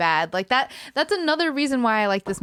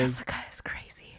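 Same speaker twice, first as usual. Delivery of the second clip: hushed gossiping whisper; 11 semitones lower, 10 dB quieter than usual. Real, not acted. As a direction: whisper it so she can't hear. Gossiping about Jessica.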